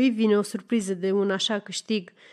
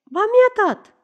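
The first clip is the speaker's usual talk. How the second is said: very high, louder retort; insistent contradiction; 11 semitones higher, 6 dB louder than usual. Acted. Woman answering her accuser, high insistent retort.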